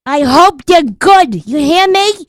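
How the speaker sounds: calling out. Acted. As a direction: shout it across to the deli. Calling out to caterer.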